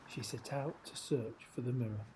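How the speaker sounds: usual speaking voice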